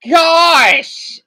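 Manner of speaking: loud, high, drawn-out outburst; exasperated exclamation. Real, not acted. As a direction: let it burst out, high and loud. Amazed and frustrated by the languages.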